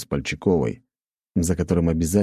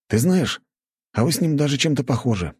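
higher-pitched schoolboy friend, conversational; friend's voice; 9 semitones higher than usual. A narrator's reading. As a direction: voice the friend higher than the narration. Narrator voices friend Naryshkin. (голос друга повыше)

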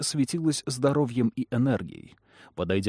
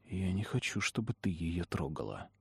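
tense, hushed warning; 4 semitones lower, 11 dB quieter than usual. A narrator's reading. Trish confronting Lamia, frightened.

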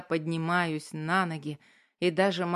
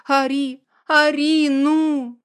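wailing, much higher and louder; sobbing wail; 9 semitones higher, 11 dB louder, slower than usual. Acted. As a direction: howl it through sobs. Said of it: "начинаю громко рыдать".

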